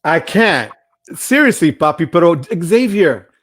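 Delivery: rising to a loud, high cry of disbelief; overwhelmed by a viewer's antics, loud disbelief; exasperated outburst. Real, not acted. Exasperated laughter at Xavier.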